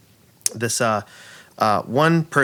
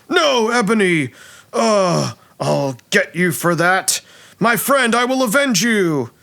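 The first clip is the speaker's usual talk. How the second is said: high, melodramatic cry and vow; hammy vengeful hero; 8 semitones higher than usual. Acted. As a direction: mourn and swear revenge like a stage actor. Dwarf actor mourns stabbed friend, vows revenge.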